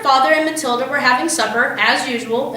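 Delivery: plain reading voice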